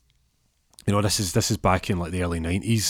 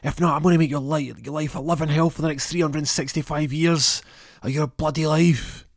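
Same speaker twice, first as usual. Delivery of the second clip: angry coach threat; 8 semitones higher than usual. Retold or imitated. Coach threatening Peter, angry shout. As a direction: threaten him with everything you've got. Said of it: "He goes".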